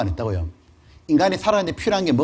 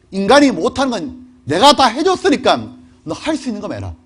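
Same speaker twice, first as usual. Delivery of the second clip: normal talking voice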